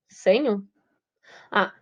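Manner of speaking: normal talking voice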